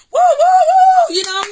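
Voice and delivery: high loud "woo" whoops; imitated crowd whooping, high and loud; audience cheer. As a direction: whoop like an excited studio audience.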